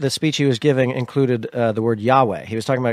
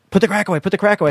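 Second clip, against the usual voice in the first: higher-pitched retold urgency; mock urgent warning; 7 semitones higher, much faster than usual. Warning the kids as their parents came home, mock urgent. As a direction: urge it hurriedly, replaying a moment of alarm.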